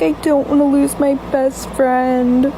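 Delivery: slow, whimpering plea; mock crying. Crying friend begging not to lose narrator, slow mock whimper. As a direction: imitate a tearful friend. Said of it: "started crying".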